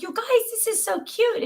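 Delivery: high delighted cooing; gushing. Retold or imitated. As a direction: sound thrilled for the boys. Praising the snowman.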